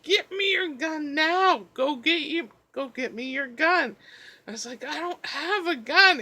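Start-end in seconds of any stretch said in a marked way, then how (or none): 0.0-4.0 insistent sleeping mom. Retold or imitated. 4.8-6.2 exasperated protest. Retold or imitated. Exasperated reply to mom.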